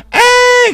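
very high, loud scream; hammed-up child's shriek; acted scream. Child finding a body in the water, mock scream.

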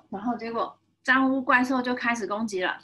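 ordinary delivery, nothing marked

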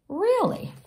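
high, drawn-out amazed really; wide-eyed disbelief. Real, not acted. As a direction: marvel at it. Listener reacting again.

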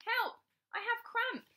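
high, loud cry for help. A narrator's reading. Rabbit cramping in the pond, crying for help. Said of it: "Suddenly, she cried".

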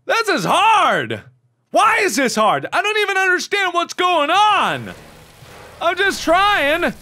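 high-pitched exasperated complaint; frustrated whine. Real, not acted. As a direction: protest at an unfair game. Frustrated at losing game.

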